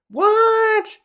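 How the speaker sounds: very high squeaked what; a child's startled squeak; shocked kids. Retold or imitated. Kids hearing they've been kidnapped, high shocked squeak.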